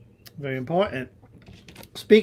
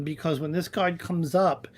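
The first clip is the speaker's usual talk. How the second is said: ordinary talk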